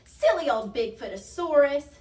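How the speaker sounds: loud, high mocking call; jeering cry. A narrator's reading. Tiny's friends mocking their snowman, loud jeer. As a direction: cry it out as a taunt. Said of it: "cried Tiny's friends".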